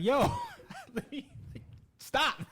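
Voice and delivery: high, breaking into laughter; laughing. Retold or imitated. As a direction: squeak it out while laughing. Retelling the waitress refilling his drink.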